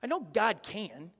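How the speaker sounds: ordinary delivery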